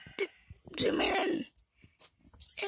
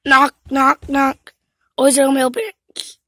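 loud knocking call; 4 semitones lower, 11 dB louder than usual. Pretend. Pretend knocking.

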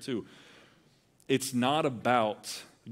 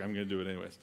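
muttered defiance; 4 semitones lower, 7 dB quieter, much faster than usual. Retold or imitated.

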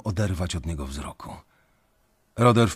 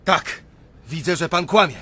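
higher and louder, defiant; defiant insistence; 9 semitones higher, 5 dB louder than usual. Acted. The narrator standing up to Pirania, loud and defiant. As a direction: stand your ground and say it out loud.

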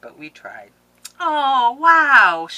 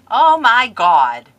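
excited; 6 semitones higher, 14 dB louder, much slower than usual. Real, not acted. Opening gifts, loud outburst.

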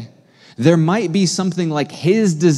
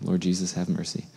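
quiet prayer; 7 semitones lower, 10 dB quieter than usual. Real, not acted. Preacher's sudden quiet prayer.

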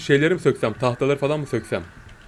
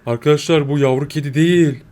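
ordinary delivery

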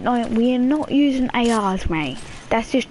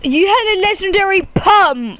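loud, high disbelieving outburst; shocked; 9 semitones higher, 5 dB louder than usual. Real, not acted. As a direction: yell it in disbelief. Shocked outburst at teammate's loot.

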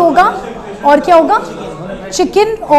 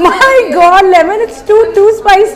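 louder and higher, half laughing as she warns; laughing alarm; 6 semitones higher, 5 dB louder, slower than usual. Real, not acted. Host warning Lemon that the chili is too spicy, laughing in alarm. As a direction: gasp it out, amused and worried at once. Friend bites the green chili.

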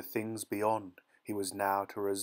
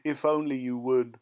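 louder narrated sob; sobbing plea; 3 semitones higher, 6 dB louder than usual. A narrator's reading. Woman weeping in his arms, acted sobbing plea. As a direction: plead through acted tears, voice pushed louder. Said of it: "she sobbed".

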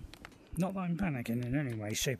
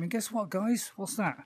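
higher, playful setup; excited teasing; 7 semitones higher than usual. Acted. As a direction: tease the audience brightly.

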